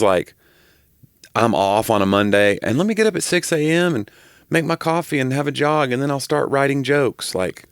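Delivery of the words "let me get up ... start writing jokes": mock chipper. Mocking ideal morning routine.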